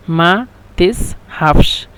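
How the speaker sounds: মা তাযহাবশ drawn out slowly, each sound clear; slow demo. Shown as a demonstration. Teacher modelling the Egyptian phrase to students, slow and clear. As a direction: spell out মা তাযহাবশ slowly for learners. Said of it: "একসাথে ওরা বলে মা তাযহাবশ".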